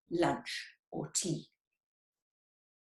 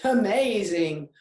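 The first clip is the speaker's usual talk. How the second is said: louder, falling amazing, lightly roared; mock roar; 2 semitones higher, 7 dB louder than usual. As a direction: boom it out like a big cat. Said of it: "roared the jaguar".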